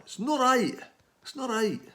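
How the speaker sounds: high-pitched Scottish protest; indignant mock-Scottish squawk; Scottish accent. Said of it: "I keep lapsing into Scottish".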